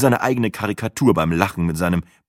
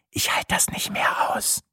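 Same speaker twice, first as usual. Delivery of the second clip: desperate voiceless whisper; 4 dB quieter than usual. Acted. Matze tells Sina he can't stand it, urgent whisper. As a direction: whisper it as a secret plea.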